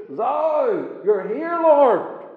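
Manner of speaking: David crying out that God is near; confident call to God. Acted. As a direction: call out to God as if He stands beside you. David's confident prayer voiced.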